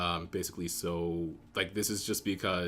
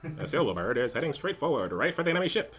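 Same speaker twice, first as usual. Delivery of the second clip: 1950s newsreel narrator, rising to the end; old-timey announcer; 7 semitones higher, 5 dB louder than usual. Shown as a demonstration. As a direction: boom it like a vintage newsreel. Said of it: "I feel like an old-timey announcer".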